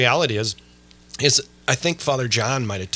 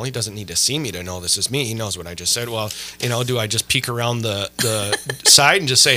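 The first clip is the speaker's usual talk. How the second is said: usual speaking voice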